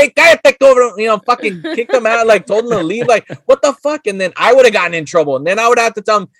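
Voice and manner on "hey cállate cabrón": high-pitched Spanish telling-off; mock angry dad. Dad kicking out the troublemaking friend, mock angry. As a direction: imitate a dad cutting his son short.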